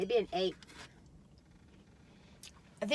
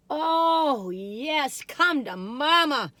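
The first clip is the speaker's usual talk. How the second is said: loud, slow, higher doting coo; come to mama coo; 5 semitones higher, 8 dB louder, much slower than usual. Pretend. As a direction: beckon the treat like a baby. Cooing to the cookie.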